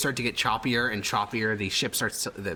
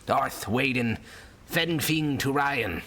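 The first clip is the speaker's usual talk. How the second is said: put-on slow, gravelly old sailor; 4 semitones higher, much slower than usual. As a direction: growl it like a weathered old seaman. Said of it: "gravelly old sailor voice".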